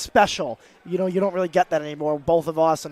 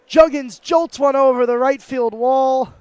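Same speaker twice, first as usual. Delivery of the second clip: higher, louder, slow broadcaster call; play-by-play home run call; 6 semitones higher, 6 dB louder, slower than usual. Pretend. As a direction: sell it like a radio call. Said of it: "inventing a home run call".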